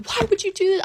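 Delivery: high-pitched, mock-crying "why"; imitated tearful plea. Girlfriend confronts boyfriend at school, imitated crying. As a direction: act out a distraught plea. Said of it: "I'm crying".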